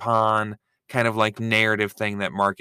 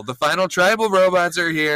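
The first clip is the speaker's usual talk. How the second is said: mock announcement; 7 semitones higher, 4 dB louder than usual.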